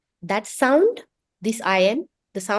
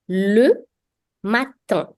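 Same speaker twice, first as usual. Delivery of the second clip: ordinary delivery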